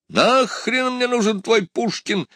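very high, drawn-out angry retort; shouting back. A narrator's reading. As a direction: shout it back at the top of your pitch. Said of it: "закричал в ответ Дантес". (ответный крик на высокой ноте)

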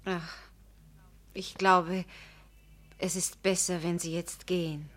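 soft, resigned dismissal; quiet, weary send-off; quiet resignation. Acted. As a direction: let the words sink, tired and low.

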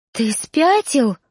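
a gasping, lightly voiced disbelief; gasped. A narrator's reading. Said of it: "ахнула обращённая".

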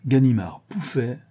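ordinary delivery

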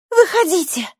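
put-on high, loud child's command; demanding. A narrator's reading. Girl orders stranger out of the tent, demanding. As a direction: order him out insistently. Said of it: "потребовала она".

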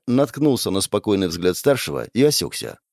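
ordinary delivery, nothing marked

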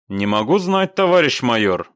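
usual speaking voice

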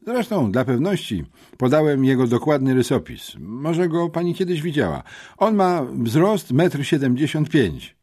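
lekko podniesiony głos rzeczowego chłopca; przejęty uczeń. A narrator's reading. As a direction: report it like an earnest schoolboy witness. (przejęty, rzeczowy uczeń)

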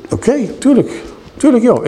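high mock-obliging agreement as Jozefat; a hammed-up yes-man going along with it; meek compliance. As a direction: play along like an easily led ally, pitched high. Jozefat meekly agrees to Agab.

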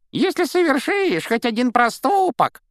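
very high, loud squeaky mockery; mimicking squeal. A narrator's reading. Malfoy mimics Ron's mother to taunt him, squealing. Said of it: "передразнивая, пропищал".

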